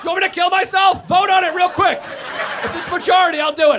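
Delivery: loud, high, panicked mock apology; frantic apology. Comic apologizes to everyone for the phone's noise, frantic loud apology. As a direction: shout a hammed-up apology to the whole room.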